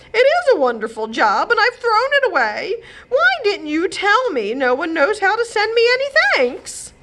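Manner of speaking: high, loud upset lament; tearful complaint. Acted. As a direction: pour out her regret. Godmother in tears complaining.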